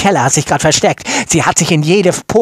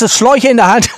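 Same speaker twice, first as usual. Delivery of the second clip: ordinary talk, nothing marked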